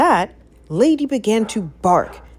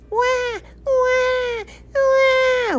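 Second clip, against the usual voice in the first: very high crying wah; high baby wah cries; baby wailing; much slower than usual. A narrator's reading. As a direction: wail like a frightened baby. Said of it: "wailed the baby".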